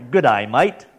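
hammed-up Australian greeting accent. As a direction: put on a playful Aussie accent. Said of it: "picked up a bit of an accent".

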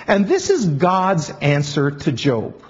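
ordinary delivery

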